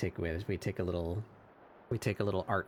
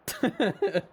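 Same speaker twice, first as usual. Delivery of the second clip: high squeal laugh; 5 dB louder than usual. Real, not acted. Laughing at trailer.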